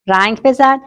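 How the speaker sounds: ordinary talk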